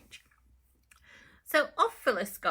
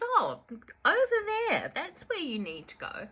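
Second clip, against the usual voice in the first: market woman pointing; 7 semitones higher, 4 dB louder than usual. Acted. Market woman answers Phyllis.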